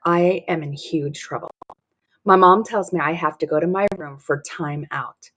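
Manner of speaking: ordinary delivery, nothing marked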